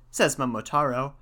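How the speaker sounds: plain reading voice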